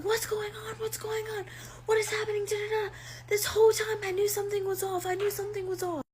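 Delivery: high-pitched mock scream of an upset wife; Nancy's screaming. Wife walking in on the two of them, imitated screaming. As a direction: imitate frantic screaming, high but not full volume. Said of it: "Nancy starts screaming".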